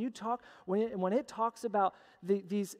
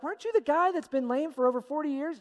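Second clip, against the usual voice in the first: higher-pitched astonished crowd, lightly acted; mock disbelief, pitched up; freaking out, acted; 6 semitones higher than usual. Onlookers question the healed lame man, mildly freaking out. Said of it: "they're all freaking out".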